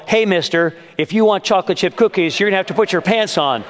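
high, half-strength scolding call; retold yell. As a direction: imitate a mom calling out an order. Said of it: "heard my daughter yell".

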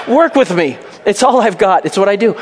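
high, loud comic self-defence; mock pleading. Preacher excusing his accent to the congregation, mock pleading. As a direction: plead jokingly for indulgence.

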